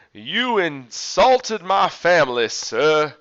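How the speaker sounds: slow, loud accusing yell; retold yelling. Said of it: "he starts just yelling at him".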